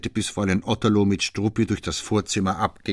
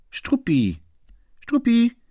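high, louder call of the dog's name; 5 dB louder, slower than usual. Acted. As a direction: call the dog through the door. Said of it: "rief Fräulein Otterlo". (hoher, lauter Ruf nach dem Hund)

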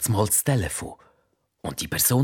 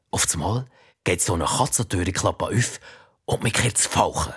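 ordinary delivery

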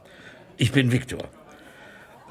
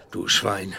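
ordinary talk

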